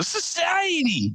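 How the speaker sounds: very high, drawn-out falsetto; mock falsetto cry. Comedian retelling his comeback to a conspiracy ranter, falsetto cry. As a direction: stretch it out way up high. Mimics shouting a one-word retort.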